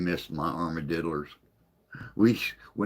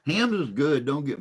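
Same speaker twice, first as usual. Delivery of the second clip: excited; 10 semitones higher, 7 dB louder than usual. Real, not acted. Sudden outburst defending beer.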